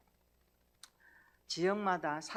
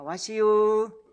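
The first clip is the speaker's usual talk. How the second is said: loud, very slow Chungcheong 와시유; Chungcheong barber's greeting; 3 semitones higher, 12 dB louder, much slower than usual. Retold or imitated. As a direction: drawl out 와시유 loudly like a country barber. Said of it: "고유의 사투리로 이렇게 인사합니다". (충청도 이발사처럼 크고 아주 느리게 "와시유")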